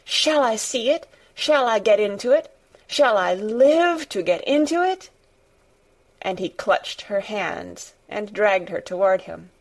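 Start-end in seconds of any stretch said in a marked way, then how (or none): 0.0-5.1 high, breathless-with-hope pleading questions; rather hysterical. A narrator's reading. Weak boy desperate to get into the garden, near hysterical. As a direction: build each question higher, desperately eager. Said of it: "weak and rather hysterical".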